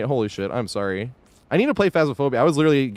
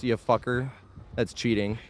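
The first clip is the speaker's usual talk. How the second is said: normal talking voice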